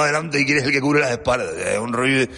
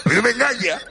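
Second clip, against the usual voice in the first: ordinary talk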